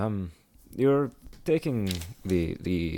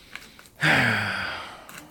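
heavy sigh; 2 semitones higher than usual. Acted. Traveller alone after scolding himself, heavy sigh. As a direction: sigh out the self-reproach. Weary self-reproach after the farewell.

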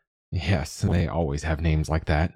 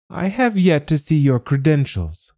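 high, haughty dragon tenor; a creature changing the subject, lofty and high; dragon's tenor voice; 9 semitones higher than usual. A narrator's reading. Dragon demanding the negotiator's papers, high tenor. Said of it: "the dragon said".

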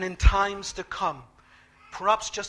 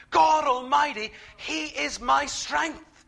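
ordinary talk, nothing marked

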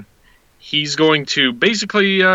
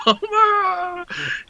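high giggle. Retold or imitated. Giddy laugh after quote.

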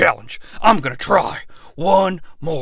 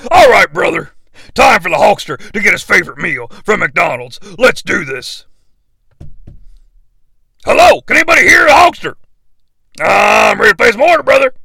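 Hulk Hogan; 3 semitones higher, 4 dB louder, faster than usual. Acted. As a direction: bellow it like a pumped-up wrestler. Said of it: "Hulk Hogan".

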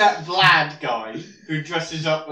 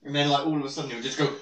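usual speaking voice

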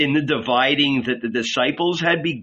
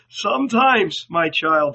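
high, fearful confession; a touch of dread, pitched up; trembling old man; 6 semitones higher than usual. A narrator's reading. Old man confiding in his child, trembling. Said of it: "he said, trembling".

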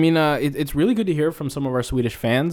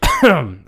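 fake coughing fit; 7 semitones higher, 7 dB louder than usual. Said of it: "oh, you're coughing".

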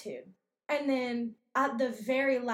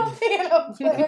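laughing disbelief; 5 semitones higher, 6 dB louder than usual. Retold or imitated. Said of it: "I said".

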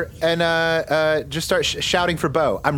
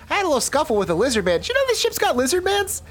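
high fast character voice; 9 semitones higher than usual. Acted. Vern in character.

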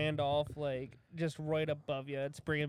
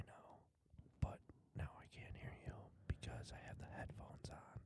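whispering; 18 dB quieter than usual. Real, not acted. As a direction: close mic whisper. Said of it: "I can whisper to you".

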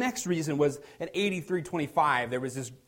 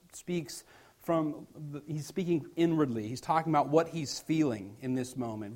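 normal talking voice

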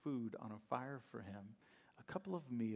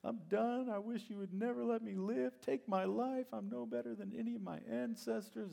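much higher, whiny complaining prayer; whining Elijah; 11 semitones higher than usual. Retold or imitated. Preacher acting Elijah whining under the tree. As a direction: moan to God like a worn-out prophet. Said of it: "he was whining and complaining".

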